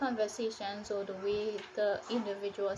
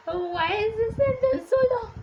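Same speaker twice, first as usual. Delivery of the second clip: high, loud mock lament; exasperated wail; 11 semitones higher, 10 dB louder than usual. Reader unable to focus on the story, exasperated. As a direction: wail it in comic despair. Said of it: "all my focus was on while reading this was".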